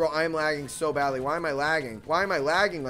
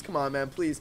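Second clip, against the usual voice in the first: ordinary talk, nothing marked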